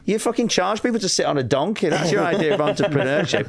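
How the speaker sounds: high-pitched indignant tirade; hammed-up outburst at a hostile crowd; mock angry rant. Retold rant at the audience.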